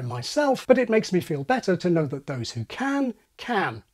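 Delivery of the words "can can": both "can" in full strong form, vowel unreduced. Shown as a demonstration. Pointing out strong forms before boundaries, "can, can" unreduced. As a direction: give "can, can" its full vowel each time. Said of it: "two strong forms of the word can".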